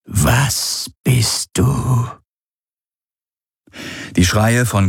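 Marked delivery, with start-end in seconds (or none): 0.1-2.1 slow, drawn-out eerie question; weak, confused alien voice. A narrator's reading. Creature probes Galen's mind, weak and confused. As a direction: ask it slowly, weak and bewildered. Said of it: "Sie klang schwach und verwirrt".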